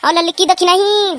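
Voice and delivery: stretched summons; calling out. Acted. Calling again for help.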